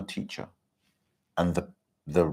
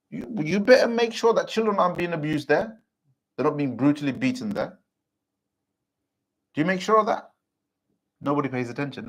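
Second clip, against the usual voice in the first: preaching rebuke; 6 semitones higher than usual. Acted. Preacher scolding the community over beatings, fervent preaching.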